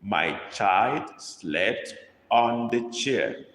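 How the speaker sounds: put-on My child slept said word by word, very slow; slow dictation. Shown as a demonstration. Teacher dictating to the class, very slow and clear. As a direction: spell it out slowly for the class to copy. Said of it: "dictating a sentence to copy".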